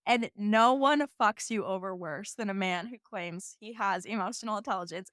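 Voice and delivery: normal talking voice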